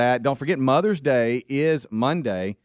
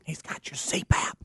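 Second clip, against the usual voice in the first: whisper; 5 semitones higher, 6 dB quieter than usual. Acted. Joking aside, whispered.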